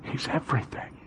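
breathy whispered afterthought; hushed after the shout. Real, not acted. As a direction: drop to a whisper. Soft echo after shout.

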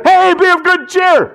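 high, loud cheery call; Jesus greeting. Acted. Jesus greets the terrified disciples, high and loud. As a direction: call out brightly over the waves. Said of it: "Jesus walks up and says".

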